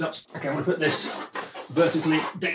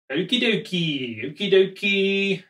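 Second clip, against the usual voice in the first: playful singsong; 3 semitones higher, slower than usual. Acted. Playful sign-off to the task.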